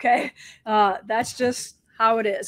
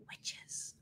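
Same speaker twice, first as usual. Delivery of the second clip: whispered; 24 dB quieter than usual. Real, not acted. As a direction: whisper the word "witches" under her breath. Word half-censored mid-sentence.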